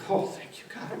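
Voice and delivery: soft, voiceless thanks with a laugh; whispered relief. Retold or imitated. Therapist relieved the children felt safe, whispered. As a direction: whisper it like a private prayer of relief. Retold private relief after answer.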